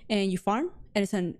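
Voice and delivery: ordinary talk, nothing marked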